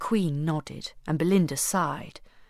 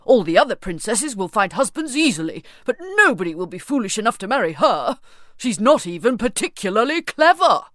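loud, sulky royal grumble rising at the end; grumbling king; 3 semitones higher, 6 dB louder than usual. Acted. King grumbling that nobody will marry Belinda, loud and scornful. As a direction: sneer it like a spoilt king. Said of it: "grumbled King Stormbelly".

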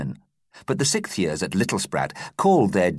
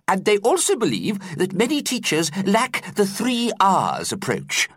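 put-on high, fretful old teacher voice; worried teacher explaining; 12 semitones higher than usual. A narrator's reading. Teacher explaining to pupils in his high worried voice. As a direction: explain it with a nervous quaver in mind.

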